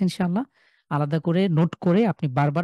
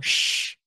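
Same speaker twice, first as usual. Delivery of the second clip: hushing sh. Shown as a demonstration. Teacher imitating the hushing shh for students. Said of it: "ঠিক ওই আওয়াজ".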